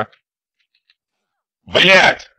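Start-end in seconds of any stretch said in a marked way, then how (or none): 1.6-2.3 furious yell at the game, high and loud; angry shout. Real, not acted. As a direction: blow up at the screen. Gamer yelling at the game. (злой крик на игру)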